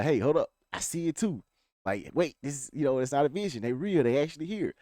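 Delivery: retold urgent warning. Partner warning the father before he shoots, urgently. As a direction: act out stopping someone in a hurry.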